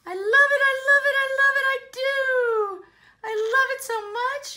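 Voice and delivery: very high, loud burst of delight; ecstatic gushing. Acted. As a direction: sing out your love at full tilt. Minnie gushes over spaghetti.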